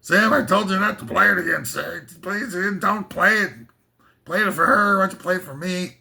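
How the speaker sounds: high-pitched film star impression; Humphrey Bogart. Movie hero pleading with Sam at the piano, mock Bogart. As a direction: ham up an old movie star. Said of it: "that's my Humphrey Bogart".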